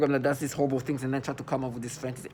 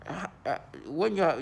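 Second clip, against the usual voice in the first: ordinary talk, nothing marked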